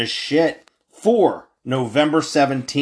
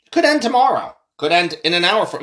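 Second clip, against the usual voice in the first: normal talking voice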